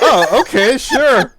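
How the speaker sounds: high, loud startled okay; surprised. Real, not acted. Surprised as another joins.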